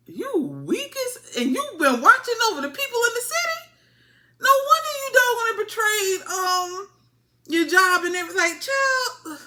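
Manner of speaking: very high-pitched mock disbelief; mock outrage. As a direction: squeal it in hammed-up outrage. Mocking a character's quick betrayal.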